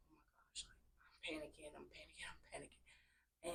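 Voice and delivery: hushed, unvoiced-sounding mock panic under the breath; whispered panic. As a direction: whisper it like a retold panicked thought. Retelling silent panic in class.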